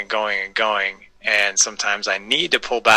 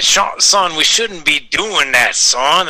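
high, louder slow cartoon rooster scolding; Foghorn Leghorn voice; 5 semitones higher, 3 dB louder, slower than usual. Telling his kids off as Foghorn Leghorn. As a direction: lecture like a blustery cartoon rooster. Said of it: "talking like that to my kids".

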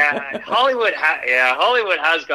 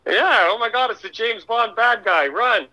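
mock alarm; 8 semitones higher than usual. Mock alarm at villain accent.

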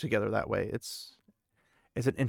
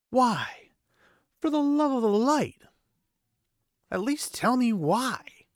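much higher and louder, pleading in anguish; desperate outburst; 12 semitones higher, 6 dB louder, slower than usual. A narrator's reading. Lan pleading with Nynaeve, desperate and angry. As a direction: beg for an answer, near breaking point.

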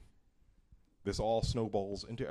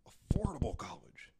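high, whispered echo of a slogan; mocking whisper; 6 semitones higher than usual. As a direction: repeat it as a breathy mock promise. Mocking echo of politician's promise.

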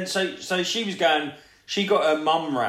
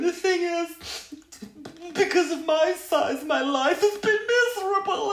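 mock crying mum. Mocking the crying mum for a mate, high wail. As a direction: squeak it out like a weepy mum. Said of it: "the mum starts crying and going".

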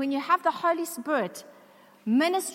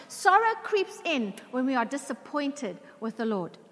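ordinary delivery

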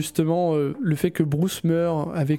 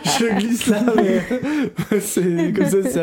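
laughing; 3 semitones higher, 4 dB louder, slower than usual. Real, not acted. Rire en parlant.

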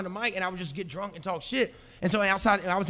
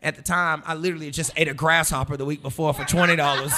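ordinary delivery

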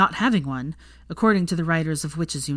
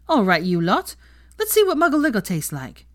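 cheery toast; 5 semitones higher, 4 dB louder than usual. A narrator's reading. Toast to friends.

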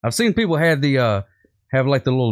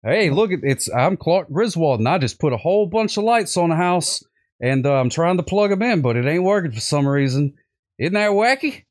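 lightly voiced goofy dad, much higher; a hint of a cheerful sitcom dad, pitched up; Clark Griswold impression; 7 semitones higher than usual. Quoting the movie to the host, lightly voiced Clark, higher. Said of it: "Is this your impression of Clark?".

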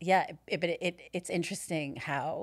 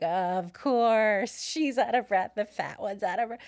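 loud, higher-pitched mocking of critics; mock judgmental; 4 semitones higher, 8 dB louder than usual. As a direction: imitate scornful gossips, exaggerated and loud. Mimicking judgmental listeners' reaction.

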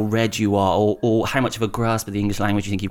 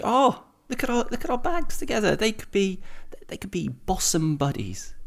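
high, bright imitation of a colleague; high-pitched colleague; 10 semitones higher, 3 dB quieter than usual. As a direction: quote her in a high voice. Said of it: "she said to me".

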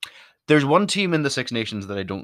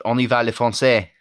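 pretentious French; 4 dB louder than usual. Acted. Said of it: "Me being pretentious with my my French".

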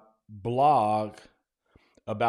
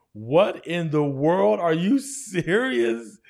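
slow, loud, high astonishment; drawn-out amazed exclamation; stunned disbelief; 7 semitones higher, 7 dB louder, much slower than usual. Retold or imitated. Stunned at the award announcement.

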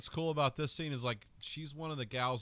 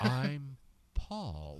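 third Paul, different voice; 2 semitones higher than usual. Retold or imitated. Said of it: "a different way three times".

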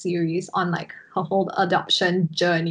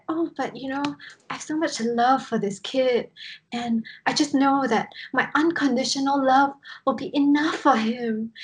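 higher-pitched imitation of an earnest mother; devoted adoptive mother; 6 semitones higher than usual. YouTuber mother insisting love will be enough, earnest imitation. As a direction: gush earnestly, pitched up, as the adoring mom. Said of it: "she said".

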